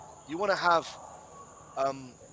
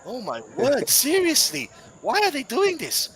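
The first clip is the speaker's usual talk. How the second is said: much higher, louder, indignant; exasperated outburst; 10 semitones higher, 6 dB louder than usual. Real, not acted. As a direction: throw up your hands at the comments. Exasperated at commenters.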